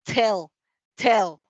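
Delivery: "tell" with a strong puff of air on the t; English "tell", airy burst on the t; aspirated English T. Shown as a demonstration. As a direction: punch out "tell", t released with breath. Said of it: "sino que decimos".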